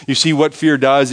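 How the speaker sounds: ordinary talk, nothing marked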